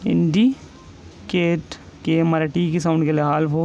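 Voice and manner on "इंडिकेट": इंडिकेट drawn out very slowly with a falling end; teacher slowly dictating इंडिकेट; slow dictation. Shown as a demonstration. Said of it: "next example है इंडिकेट".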